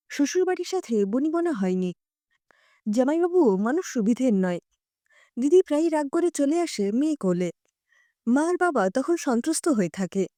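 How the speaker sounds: ordinary delivery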